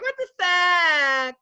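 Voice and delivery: high, loud, slow drawn-out outrage; mock outraged girl. Imitating the girl whining at the heckler. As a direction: stretch out the shocked whine.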